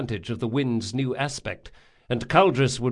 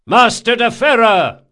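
loud, high summoning shout; called out; 11 semitones higher, 11 dB louder than usual. A narrator's reading. Captain calling his first mate, loud shout. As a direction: call the mate from across the deck. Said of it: "called Locke".

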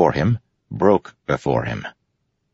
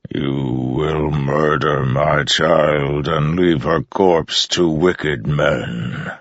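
very slow, mournful godlike voice; deep, regretful storm voice; much slower than usual. A narrator's reading. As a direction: warn with sorrow, vast and heavy. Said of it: "sounded regretful, mournful".

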